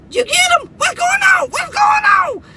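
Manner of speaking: high, loud panicked shrieking wife. Retold or imitated. Wife screaming at her husband in the dark, high panicked shriek. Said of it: "And she's like".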